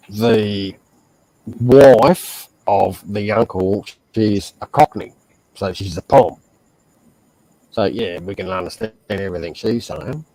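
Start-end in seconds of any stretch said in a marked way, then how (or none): none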